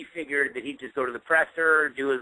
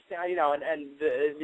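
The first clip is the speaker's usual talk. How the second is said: ordinary talk, nothing marked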